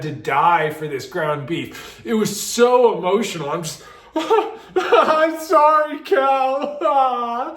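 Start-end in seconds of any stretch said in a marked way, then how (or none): 4.2-7.5 slow, high, laughing apology to the meat; drawn-out, high giggling sorry; giggly apology. Retold or imitated. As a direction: say sorry to a dead cow through giggles. Tripping, apologising to ground beef.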